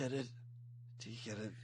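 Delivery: low, soft tough-guy voice; Snake voice again. Pretend. Host joking as Snake to co-host, low and soft. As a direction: keep it low and quiet.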